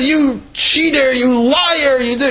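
high, slow, indignant priest; outraged accusation. Acted. Priest bursting in on the cheating convert, high and outraged.

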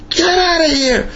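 very high, strained angry squeal; acted-out furious tirade; mock screaming. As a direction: throw him out in a pretend screech. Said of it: "He starts screaming at him".

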